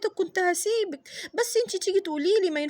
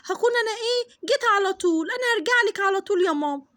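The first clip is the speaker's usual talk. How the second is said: put-on insistent little girl; 2 semitones higher than usual. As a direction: rush to convince mum. Daughter insists, quick promise.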